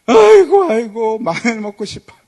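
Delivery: high, loud mock wail crying for garlic. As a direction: wail it like a hammed-up crying crowd. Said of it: "다 목 놓아주는 겁니다". (과장되게 목 놓아 우는 소리)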